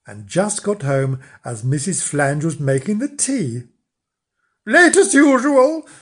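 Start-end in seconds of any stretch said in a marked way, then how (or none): 4.7-5.9 high, slow, loud reproach; scolding wife. Acted. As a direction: tut at him from on high. Wife greets late husband.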